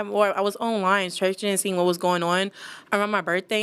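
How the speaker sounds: ordinary talk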